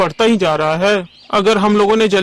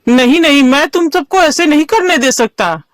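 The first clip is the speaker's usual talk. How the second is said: higher, urgent, emphatic protest; defiant refusal; 4 semitones higher than usual. Acted. Gopal refusing to let villagers kill the cub, defiant. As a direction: refuse forcefully, defending the little one.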